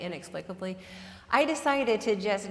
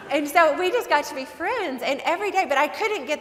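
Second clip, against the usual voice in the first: laughing; 6 semitones higher, 5 dB louder than usual. Real, not acted. Laughs through the story.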